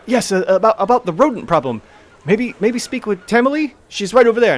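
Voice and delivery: an eager elf pointing the way; elf helper. Acted. An elf in the hall pointing adventurers to Tamili, lightly voiced.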